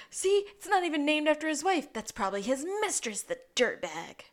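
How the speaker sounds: high, snide mock gossip; lightly voiced smug detective; smug sneer. Lassie sneering about the dead man's mistress.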